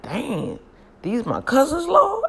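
rising to a loud high squeal; amazed exclamation. Retold or imitated. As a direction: cry out to the Lord, amazed. Admiring her fine cousins.

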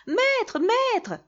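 very high calling cry; shrill alarm calling the master. A narrator's reading. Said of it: "La harpe se mit à crier".

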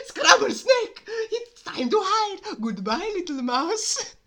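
very high, shrieking cartoon panic; hammed-up terror, high and loud; panicked snake. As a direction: yelp it out loud and flee. Snake panics and flees in story.

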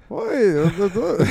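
high, loud leprechaun noises; failed cartoon leprechaun; attempted leprechaun. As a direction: fumble at a mock Irish leprechaun voice. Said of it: "try to sound like a leprechaun".

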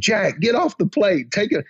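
high-pitched mock yell; retold scream. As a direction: imitate yelling at someone to stop. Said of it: "And I'm screaming".

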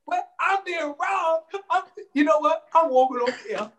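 mock outrage. Playful indignation about his check.

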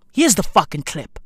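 normal talking voice

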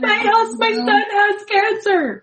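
high, sing-song mock panic; hammed-up frantic mother at the doctor; panicked mom. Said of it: "I'd be going in and being like".

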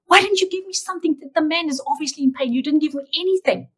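high-pitched indignant complaint, volume held back; retold anger. Patient demanding answers from God after the elevator, retold angry protest. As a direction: act out being mad at God, pitch jumping up. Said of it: "I was so mad at God".

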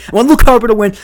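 insist: I want Luke Harper to win. Shown as a demonstration.

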